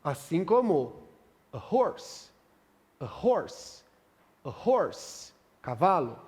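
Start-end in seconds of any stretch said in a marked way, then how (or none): 1.5-5.3 "horse" with a breathy English H; aspirated h. Shown as a demonstration. Teacher modelling the spoken H in "horse". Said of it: "Ambos Hs aqui têm som, um som aspirado".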